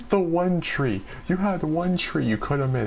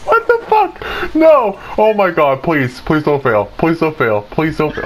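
loud panic, shrieked at first, then dropping; panicked pleading; 8 dB louder than usual. Real, not acted. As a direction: beg frantically for it not to fail. Panicked pleading as the game goes wrong.

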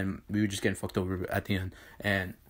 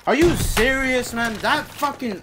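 loud high exasperated yelling; furious shout; 11 semitones higher, 7 dB louder, much slower than usual. Real, not acted. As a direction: yell at the broken rocket. Rocket failure outburst.

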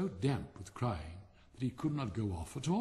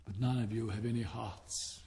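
ordinary delivery, nothing marked

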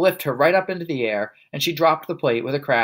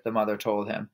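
ordinary delivery, nothing marked

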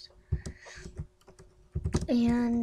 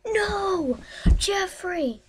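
dramatic cry; 8 semitones higher, 4 dB louder, much slower than usual. Pretend.

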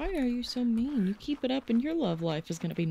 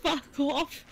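high mock British snap; British accent; 6 semitones higher than usual. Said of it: "You even said that in a British accent".

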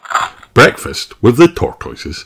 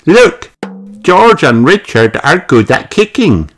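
put-on high, excited little-girl voice; Peppa; 9 semitones higher than usual. A narrator's reading. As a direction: pipe up high to a friend. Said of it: "says Peppa to Rebecca".

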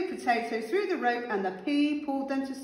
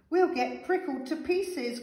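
higher, mildly alarmed cry; worried cry; 6 semitones higher than usual. A narrator's reading. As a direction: voice a fretful little character in dismay. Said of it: "cried Tomato".

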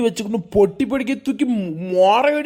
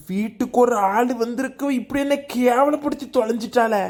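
ordinary delivery

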